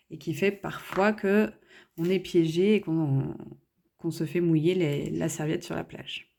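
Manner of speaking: usual speaking voice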